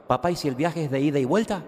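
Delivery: put-on high, eager little boy's question; mock child voice. Son asking his father, in a lightly voiced child tone.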